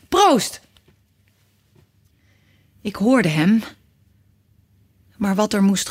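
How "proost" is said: high mock-hearty toast with falling end; a cheery old man's toast, pitched up. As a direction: raise the glass and call it out high. Old man toasting the narrator.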